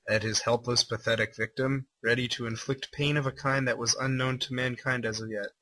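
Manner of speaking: ordinary talk, nothing marked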